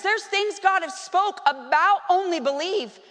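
ordinary talk

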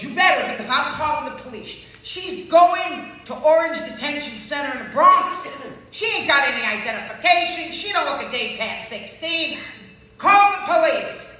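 loud, slow furious yelling; angry shout. Retold or imitated. Bystander shouting at the crowd to call the police, loud and angry. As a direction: bark it out, demanding police.

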